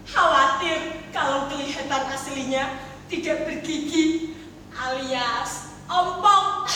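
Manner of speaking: usual speaking voice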